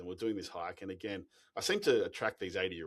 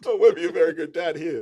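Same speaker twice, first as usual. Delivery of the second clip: much higher and louder, amused; laughing joke; 10 semitones higher, 16 dB louder than usual. Real, not acted. Dad joking to the host, laughing loudly.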